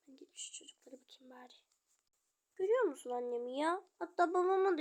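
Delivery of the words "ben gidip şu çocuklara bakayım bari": quick unvoiced whisper; fast whisper. Father muttering about checking the kids, fast whisper. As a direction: rush it under the breath. Quiet aside in doll play.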